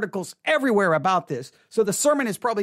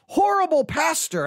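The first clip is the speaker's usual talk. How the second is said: mock outrage; 7 semitones higher than usual. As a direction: imitate fired-up critics, drawing out the indignation. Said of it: "all fired up".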